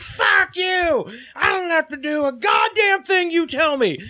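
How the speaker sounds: high shouted rant; mock cussing out. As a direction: imitate a woman screaming at staff. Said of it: "cussing up a storm at him".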